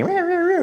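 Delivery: high-pitched catty "rah rah"; catty mock yowling. Mocking a feminized way of standing up for yourself, catty.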